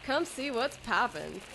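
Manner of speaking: ordinary delivery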